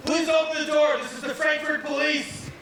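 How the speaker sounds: very high, put-on voice of the police at the door; mock police call. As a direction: squeak it out like a cop shouting through a door. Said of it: "And they were like".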